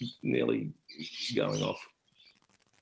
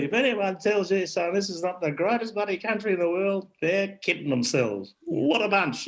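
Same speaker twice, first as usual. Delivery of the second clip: put-on much higher old bloke, earnest and proud; Ron Palmer; 10 semitones higher than usual. Old Ron advising the young host about the country, high and proud. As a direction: declare it like a proud old digger. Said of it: "he said to me".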